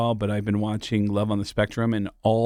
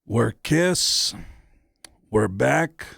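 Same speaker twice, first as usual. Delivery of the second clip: pause, then announce it grandly; much slower than usual. Retold or imitated. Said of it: "And he said".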